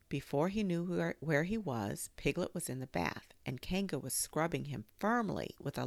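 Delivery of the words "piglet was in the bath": ordinary delivery, nothing marked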